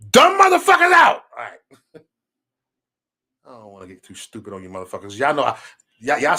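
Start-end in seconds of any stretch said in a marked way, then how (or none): 0.1-1.2 high, punched-out yell; loud cussing out. Acted. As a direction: burst into a loud, pitched-up scolding. Mock cussing out the viewers.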